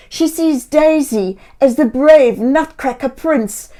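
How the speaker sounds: ordinary delivery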